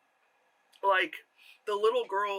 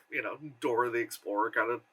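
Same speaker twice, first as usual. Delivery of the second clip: ordinary delivery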